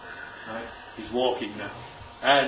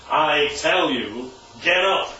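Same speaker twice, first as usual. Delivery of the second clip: slow, weighty "I tell you"; emphatic command; slower than usual. Acted. Preacher voicing Jesus to the paralysed man, slow and emphatic. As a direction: stress "tell" in "I tell you" and lean on each word. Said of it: "makes it quite emphatic".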